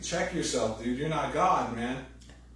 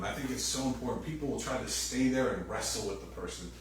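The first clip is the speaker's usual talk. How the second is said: ordinary talk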